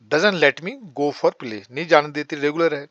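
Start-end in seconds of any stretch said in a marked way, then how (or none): 0.1-1.6 slow, word-by-word model; slow dictation. Shown as a demonstration. Teacher slowly models the English translation for learners, deliberate. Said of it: "my mother".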